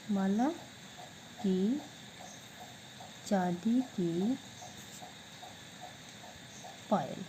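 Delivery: each word of माला की चांदी की पायल spoken apart with long pauses; slow dictation. Shown as a demonstration. Teacher dictating the answer to students, slow and separated. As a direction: spell it out word by word for the class to copy. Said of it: "तो क्या बन गया?".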